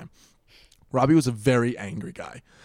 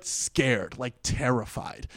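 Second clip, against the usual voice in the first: ordinary talk, nothing marked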